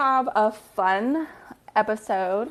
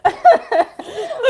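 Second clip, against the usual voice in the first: loud, very high cackle; real high, loud laugh; burst of laughter; 8 dB louder than usual. Real, not acted. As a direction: shriek with laughter. Laughing over the prank.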